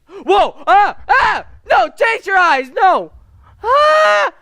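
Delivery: very high, very loud real screams of fright; terrified screaming. Real, not acted. Gamer screams at the horror jump scare, terrified. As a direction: shriek in genuine panic.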